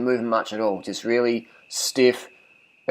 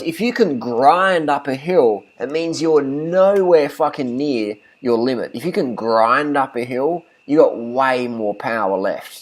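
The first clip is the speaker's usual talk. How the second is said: emphatic rant; 3 semitones higher, 4 dB louder, slower than usual. Real, not acted. Emphatic rant about grinding up hills.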